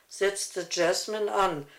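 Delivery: usual speaking voice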